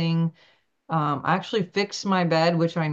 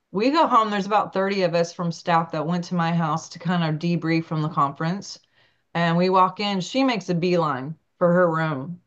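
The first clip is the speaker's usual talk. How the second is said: ordinary talk, nothing marked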